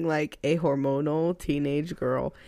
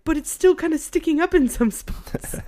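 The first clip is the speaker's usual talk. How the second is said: imitating son; 8 semitones higher than usual. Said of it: "he's like".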